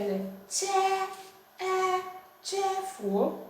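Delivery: CHE and E held on a high, level tone, then CHEFUO; sound-by-sound demonstration. Shown as a demonstration. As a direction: sustain each sound high, then join them into CHEFUO. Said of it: "so you see here".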